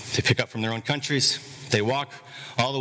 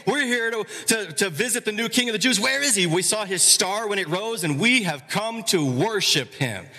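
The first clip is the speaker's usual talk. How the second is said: high, excited travelers asking for the king; bright, eager, pitched up; eager magi; 8 semitones higher than usual. Retold or imitated. Magi asking Jerusalem for the new king, eager.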